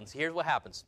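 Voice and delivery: ordinary talk, nothing marked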